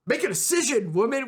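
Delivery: high, loud mock yell. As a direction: bark it like a fed-up mentor. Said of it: "yelling at Venli".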